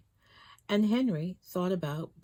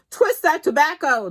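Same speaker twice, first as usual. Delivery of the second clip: boss yelling; 12 semitones higher, 10 dB louder than usual. A narrator's reading. Boss orders Henry.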